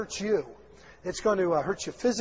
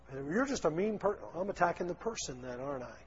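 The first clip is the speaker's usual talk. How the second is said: normal talking voice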